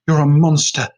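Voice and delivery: loud bitter accusation; angry accusation. A narrator's reading. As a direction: spit it at her. Daughter accuses mother.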